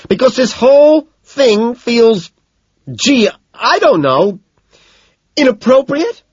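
very high, loud, drawn out; sarcastic scream. A narrator's reading. Evelyn explains why she's not having fun, sarcastic scream. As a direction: scream it with heavy pauses. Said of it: "she screams".

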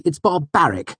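high, emphatic outrage; hot indignation. A narrator's reading. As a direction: hammer out the last word indignantly. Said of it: "Simon said hotly".

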